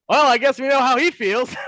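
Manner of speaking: high, loud mock reply; retold colleague's quip. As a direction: act out his gleeful comeback loudly. Acting out Paul's quip.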